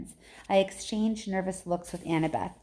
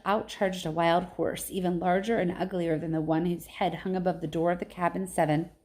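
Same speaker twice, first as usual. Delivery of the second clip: plain reading voice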